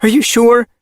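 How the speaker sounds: urgent. Acted. Worried mother.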